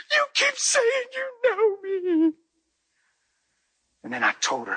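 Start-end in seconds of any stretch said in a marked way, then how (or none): 0.1-2.3 high, slow, crying woman's voice; sobbing woman. Retold or imitated. Woman breaking down to the pastor, high and sobbing. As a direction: wail it through tears.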